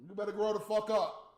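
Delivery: normal talking voice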